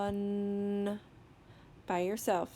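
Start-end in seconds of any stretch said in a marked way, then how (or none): 0.0-1.0 "Fun" with every sound held long; slow sounding-out. Shown as a demonstration. Teacher models a word for children, "Fun" drawn out slowly. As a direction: stretch "Fun" out slowly without breaks. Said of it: "saying the word slowly".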